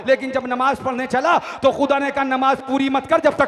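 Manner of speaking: loud, driving sermon narration; impassioned rhythmic declamation; full-force preaching. A narrator's reading. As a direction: build each line toward a climax.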